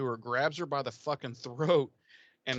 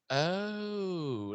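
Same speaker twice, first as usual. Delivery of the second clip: high, drawn-out oh; mock realization; 7 semitones higher than usual. Giles finally realizing, drawn-out high oh. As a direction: let it dawn slowly, pitched up. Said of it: "Giles finally is like".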